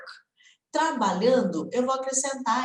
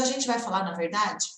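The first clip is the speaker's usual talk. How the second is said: usual speaking voice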